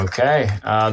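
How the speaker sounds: ordinary talk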